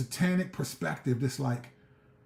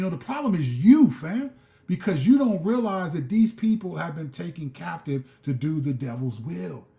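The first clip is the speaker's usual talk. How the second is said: ordinary delivery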